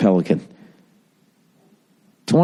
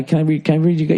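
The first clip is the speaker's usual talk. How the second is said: usual speaking voice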